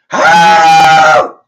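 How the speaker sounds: loud high whoop of excitement; sudden loud thrilled yell; excited shout. Real, not acted. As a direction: shout it out like you caught the scent. Excited about smelling blood.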